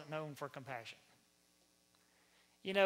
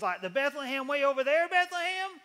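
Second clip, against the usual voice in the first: loud, high-pitched incredulous wife; Mary; 7 semitones higher, 11 dB louder than usual. Acted. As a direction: rise into a shrieked question. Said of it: "Mary says".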